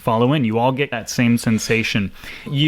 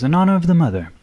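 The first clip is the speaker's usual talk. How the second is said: higher-pitched ceremonial salute; Bert; 7 semitones higher, 3 dB louder, much faster than usual. Acted. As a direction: lift the pitch high on "honor", then let it fall. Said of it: "And Bert does a little bow and goes".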